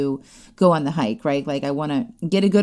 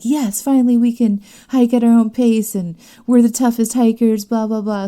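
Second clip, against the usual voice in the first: louder, higher gleeful boast; mock triumph; 6 semitones higher, 6 dB louder than usual. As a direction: act out a hikers' victory cheer. Acted cheer at finally hiking faster.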